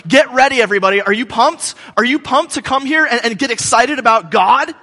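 rally the crowd at the gates; hype man. Retold or imitated. Temple opener rallying pilgrims, excited hype. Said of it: "he's like".

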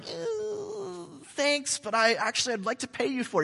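high, hesitant, overly polite decline; polite refusal. Acted. Abraham politely declining Ephron's gift, high and hesitant. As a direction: play coy, turning down the gift. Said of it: "Abraham's like".

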